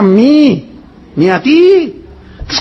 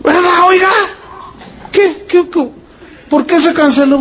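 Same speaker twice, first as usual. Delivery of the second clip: much higher, shouted mock outrage; mock upset traveler; 9 semitones higher than usual. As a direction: imitate a traveler yelling at the gate. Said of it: "Toda la gente está gritando".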